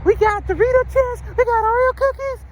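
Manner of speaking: teacher mimicking kids showing off snacks, in a high kid voice; imitating kids. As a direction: squeak it like a proud little kid.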